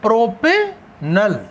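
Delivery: Propanal drawn out, paused before नल; slow dictation. Shown as a demonstration. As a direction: split propanal slowly into प्रोपे and नल. Teacher repeats name in parts.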